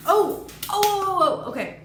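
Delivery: high, loud stunned gasps of oh; real shock while reading; rising outcry of disbelief; excited oh oh. Real, not acted. Reacting to the bite scene.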